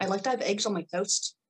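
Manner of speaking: light, fairly convincing Irish lilt; decent Irish accent. Pretend. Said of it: "that was actually pretty good".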